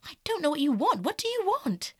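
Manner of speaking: high, exasperated demand; agitated, bewildered outburst; exclaiming. A narrator's reading. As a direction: demand it with pitch climbing. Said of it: "he exclaimed".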